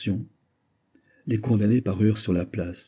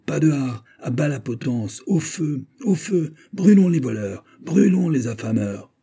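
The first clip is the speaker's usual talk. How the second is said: high, urgent rabble-rousing cries; mob shouting; 7 semitones higher than usual. A narrator's reading. Rioters cry out to rouse the hesitating people, mob shouting. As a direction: fire off slogans as agitators stirring a crowd. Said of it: "commençaient à crier en même temps".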